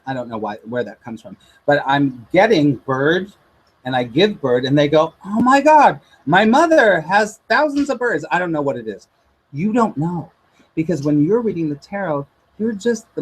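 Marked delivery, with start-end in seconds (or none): 5.3-8.3 amazed exclaiming client. Retold or imitated.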